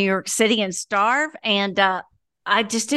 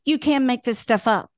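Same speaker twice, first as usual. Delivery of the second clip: ordinary delivery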